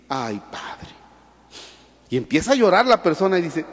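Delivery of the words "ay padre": sighing penitent. Retold or imitated. Penitent sighs to priest.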